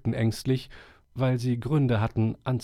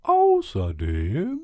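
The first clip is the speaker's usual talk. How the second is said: put-on higher, solemn old owl voice; 3 semitones higher, 3 dB louder, much slower than usual. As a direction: speak like a wise old owl addressing the forest. Owl resumes.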